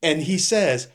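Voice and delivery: ordinary delivery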